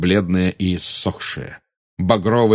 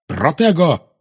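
shouted spell; 8 semitones higher than usual. Acted. Harry casts a shield spell.

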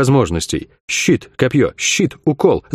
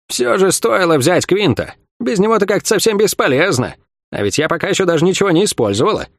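higher-pitched needling jibe; taunting old fighter; 6 semitones higher than usual. A narrator's reading. Fighter belittles her opponent mid-duel, taunting.

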